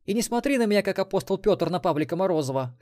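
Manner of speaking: high, loud woman's retort; рявкнула. A narrator's reading. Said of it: "рявкнула та". (рявкнуть высоким женским голосом)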